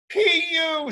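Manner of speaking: high, disgusted cry. Acted. Petey to the skunk stench, high disgusted cry. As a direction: recoil from the smell.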